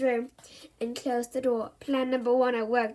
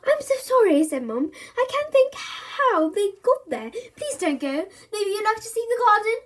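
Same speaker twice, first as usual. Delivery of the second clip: pleading mum; 8 semitones higher, 5 dB louder than usual. Acted. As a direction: beg them to stay.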